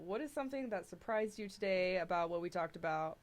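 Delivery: usual speaking voice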